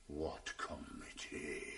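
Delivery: low, slow, held-back menace; barely contained fury, slow and low; seething low. Acted. Husband mocks wife's word, low and menacing.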